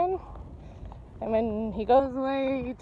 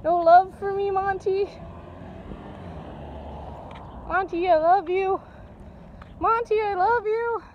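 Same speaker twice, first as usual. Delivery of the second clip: higher pleading sing-song; affectionate calling; 5 semitones higher than usual. Real, not acted. Owner pleading for affection from dog Monty, higher sing-song. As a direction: beg the dog for love. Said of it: "calling affectionately".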